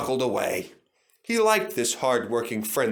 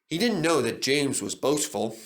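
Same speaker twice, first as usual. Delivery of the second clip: ordinary delivery, nothing marked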